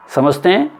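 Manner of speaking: normal talking voice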